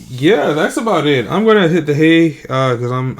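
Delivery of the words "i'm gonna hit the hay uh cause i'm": ordinary talk, nothing marked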